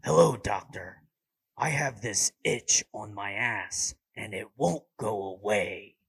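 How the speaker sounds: patient calling the doctor as Batman. Pretend. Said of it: "phone call with the doctor".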